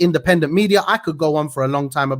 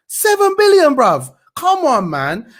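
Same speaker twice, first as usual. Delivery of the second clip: much higher and louder, incredulous outburst; outraged disbelief; 9 semitones higher, 4 dB louder, slower than usual. Real, not acted. Vlogger outraged at the seven billion bailout, incredulous outburst. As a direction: explode at the number.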